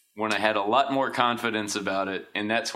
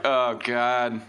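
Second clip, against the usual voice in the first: normal talking voice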